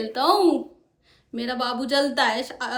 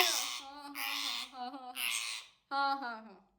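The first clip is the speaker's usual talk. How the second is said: much softer repeated murmur; hushed pet soothing; 14 dB quieter than usual. Real, not acted. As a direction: soothe the bird softly. Soft soothing to parrot.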